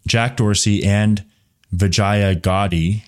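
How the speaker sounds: normal talking voice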